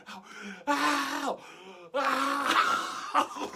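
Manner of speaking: loud high imitated screaming; mock howls of pain. Arm stuck on counter, loud hammed-up pain howls. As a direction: howl as if being burned, hammed-up. Arm pinned under hot spatula.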